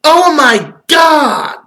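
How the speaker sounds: high, slow, shouted disbelief; shocked outburst. Acted. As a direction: explode in fake shock at the discovery. Mock shock at Zelda theory.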